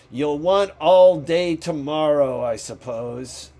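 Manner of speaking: put-on loud, grudging old miser; grudging Scrooge. A narrator's reading. Scrooge to his clerk about Christmas, loud and grudging. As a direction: bark it at him like a resentful employer. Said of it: "said Scrooge".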